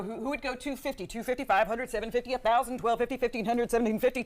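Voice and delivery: quick-fire auctioneer chant, numbers run together; rapid auctioneer talk. Shown as a demonstration. Auctioneer showing Linda the bid call, rapid auctioneer talk. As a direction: rattle off the bids in a running auctioneer patter. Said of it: "show you how to do it".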